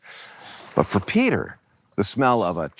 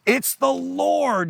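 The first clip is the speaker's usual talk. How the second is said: high, stretched-out cry; acted shout on a soaring pitch; excited mock yell; 12 semitones higher, slower than usual. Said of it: "yells John".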